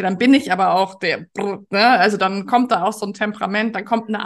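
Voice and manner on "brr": quick trilled brr burst; trilled sound of fiery Spanish; rolled brr. Shown as a demonstration. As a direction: roll out a brr to show Spanish temperament. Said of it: "wenn ich Spanisch spreche".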